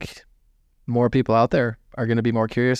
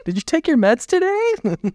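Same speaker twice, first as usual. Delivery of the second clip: high mocking voice; 3 dB louder than usual. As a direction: tease her in a high voice. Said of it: "It's like the second thing she asks".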